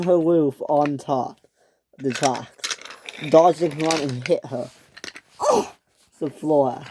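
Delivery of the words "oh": very high startled oh; shrill startled oh; high cry. Acted. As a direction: cry out as you're hit. Flora hit by Dodge.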